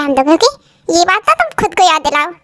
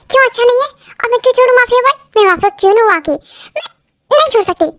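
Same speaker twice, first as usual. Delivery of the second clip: defiant retort; 7 semitones higher than usual. Acted. Defiant argument with husband.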